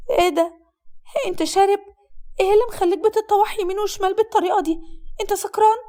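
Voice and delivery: high-pitched, appalled female voice; alarmed young bride. Acted. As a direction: question him in rising alarm, pitched high.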